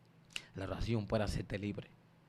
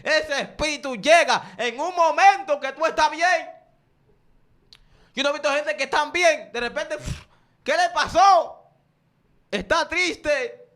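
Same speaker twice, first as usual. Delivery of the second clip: much louder and higher, near a shout; preacher's shout; 15 dB louder than usual. Acted. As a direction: shout it out at the peak of the sermon.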